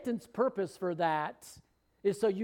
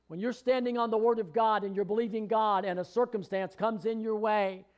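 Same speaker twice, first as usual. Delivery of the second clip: ordinary delivery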